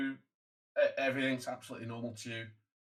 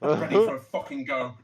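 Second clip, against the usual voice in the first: loud laugh through the words; laughing loudly; 4 semitones higher, 9 dB louder than usual. Real, not acted. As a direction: burst out laughing mid-joke. Crude joke, laughing loud.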